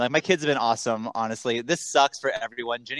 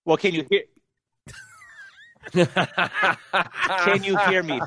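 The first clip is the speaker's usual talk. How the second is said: breaking up on a bad line; words dropping in and out; choppy cut off endings; 5 semitones higher, much slower than usual. Real, not acted. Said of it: "You're cutting in and out".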